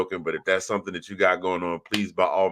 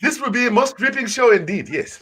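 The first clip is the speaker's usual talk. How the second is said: much higher, louder, over-refined gentleman; laughing mock-posh delight; put-on posh accent; 14 semitones higher, 5 dB louder than usual. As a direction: ham it up like a pompous host, laughing through it. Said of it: "I should do the entire show in this accent".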